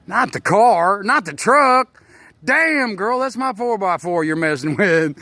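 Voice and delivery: put-on high, loud pleading with the girl; hammed-up outraged yelp; mock protest. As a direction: cry out as if stopping someone wrecking your truck. Acts out protest to truck-wrecking girl.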